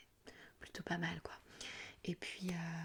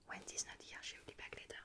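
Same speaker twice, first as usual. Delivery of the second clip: quicker, much softer repeat in English; quick phrase run-through; 10 dB quieter than usual. Shown as a demonstration. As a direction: rattle off the phone line under your breath. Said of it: "tu dis la phrase et bam".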